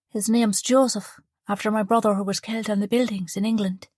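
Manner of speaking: put-on young mother proudly naming her baby, in a light southern Irish voice; southern Irish girl. A narrator's reading. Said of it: "The girl's accent was southern".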